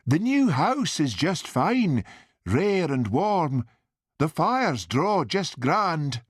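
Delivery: high, slow, lilting mother's voice; chatty mother. A narrator's reading. Mother praising new house to son, slow high lilt. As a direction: draw out the words as a contented mother.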